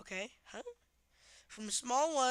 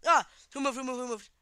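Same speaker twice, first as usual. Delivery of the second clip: startled gamer; 2 semitones higher, 10 dB louder than usual. Real, not acted. Game scare.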